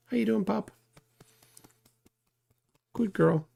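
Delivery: high, sweet puppy voice. Real, not acted. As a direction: coo at the dog. Talking to the puppy.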